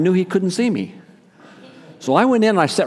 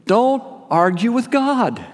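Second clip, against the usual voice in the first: slow, higher, every word weighed; slow preaching emphasis; 7 semitones higher, slower than usual. Real, not acted. Preacher warning the congregation, slow and emphatic. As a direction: lay it down slowly to the congregation. Preacher drives home his point.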